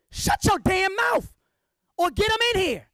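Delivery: angry shout. Real, not acted. Speaker scolding gossipers, angry slow shout. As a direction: bark it out, fed up with gossip.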